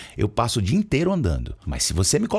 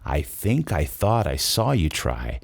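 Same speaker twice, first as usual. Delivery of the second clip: slow, lower run through "I think I thought I saw you try"; slow full-phrase drill; 7 semitones lower, slower than usual. Shown as a demonstration. Teacher finishes the practice drill for students, slow careful model. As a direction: model the whole long phrase slowly, joined up. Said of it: "dificuldade com uma frase grande".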